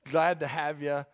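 sincere, easy greeting without the teasing; welcoming. Shown as a demonstration. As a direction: greet a guest kindly, as an usher should. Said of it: "Be welcoming".

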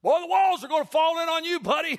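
hammed-up high, loud heckle; mock-shocked greeting. Shown as a demonstration. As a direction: rib a rare churchgoer at full volume. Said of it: "Don't do that".

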